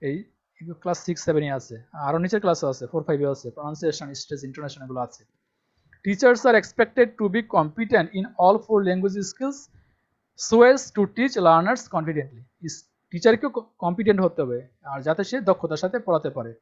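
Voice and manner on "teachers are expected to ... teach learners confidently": reads the English line out very slowly and loudly, word by word; slow dictation reading. Shown as a demonstration. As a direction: spell it out slowly for students to copy. Reading syllabus text aloud. (ধীরে ধীরে জোরে পড়ে শোনানো)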